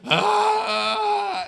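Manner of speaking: loud high fake wail; mock bawling wail. Mom bawls at border guard, loud high wail. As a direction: wail like a sobbing pregnant woman. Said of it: "sees my mom going".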